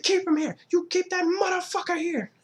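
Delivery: very high hammed-up rage; frantic mock-furious yelling; flipping out. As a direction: screech it like an enraged movie gangster. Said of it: "starts flipping out and stuff like that".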